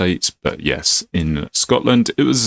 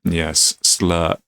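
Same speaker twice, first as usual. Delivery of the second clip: ordinary talk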